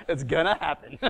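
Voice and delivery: loud, high laughter through the words; real laughing fit; laughing hard. Real, not acted. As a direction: crack up at your own bravado.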